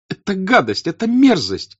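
very high, loud, outraged cry; Natasha shouting. Acted. Natasha bursting in on her mother, shouting. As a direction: hurl the words, outraged. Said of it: "закричала она".

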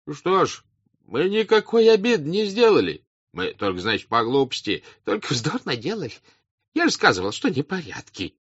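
high, protesting crowd voices; squabbling excuses; reproachful peasant voices. A narrator's reading. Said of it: "послышались голоса, упрекавшие друг друга".

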